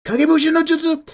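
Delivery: very high, loud attack-name cry; anime battle shout. Acted. Host acting out shonen battle shouting, anime battle shout. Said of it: "battle shouting and saying attack names out loud".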